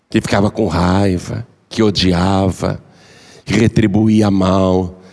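low, slow, soothing counsel; calm wise man. Acted. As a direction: speak gently like an old sage. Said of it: "Aí o sábio disse". (sábio calmo, voz grave e lenta)

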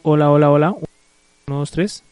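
very loud, level mic check; prueba de micrófono. Real, not acted. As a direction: test the mic loudly, rising at the end. Probando el micrófono. (prueba de sonido en voz muy alta)

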